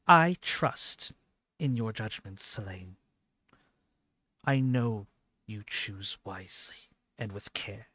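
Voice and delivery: lightly voiced goddess, pausing between phrases; formal and deliberate, with a strong opening; measured, trusting goddess. Game master plays goddess Anwa.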